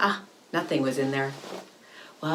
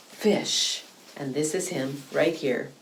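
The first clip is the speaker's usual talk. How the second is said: plain reading voice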